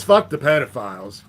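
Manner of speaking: ordinary talk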